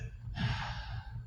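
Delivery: long tired exhale; weary sigh. Acted. The boss giving up on his cop, a weary sigh. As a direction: sigh before speaking. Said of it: "he sighs as he says".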